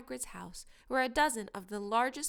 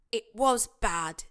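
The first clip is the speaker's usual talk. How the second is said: slow, hoarse and sickly; 9 dB louder, slower than usual. A narrator's reading. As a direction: rasp it out slowly, queasy. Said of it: "said Ron hoarsely".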